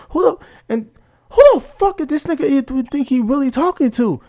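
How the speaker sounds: high-pitched, incredulous angry outburst; indignant inner outburst. Retold or imitated. Thinking to himself about the man threatening him, high-pitched indignation. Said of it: "I'm just thinking to myself".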